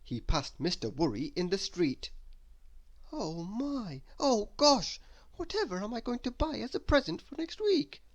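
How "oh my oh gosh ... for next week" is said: anxious fretting in a much higher voice; worried muttering. A narrator's reading. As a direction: wring your hands and fret aloud. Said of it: "muttered Mister Worry to himself".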